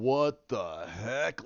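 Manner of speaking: very slow, drawn-out exclamation; stunned disbelief. Retold or imitated. Viewer reacting to badass fight, drawn-out astonishment. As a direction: stretch every word in amazement.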